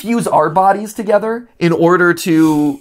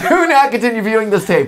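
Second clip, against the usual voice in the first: laughing warning parody; 6 semitones higher, faster than usual. Pretend.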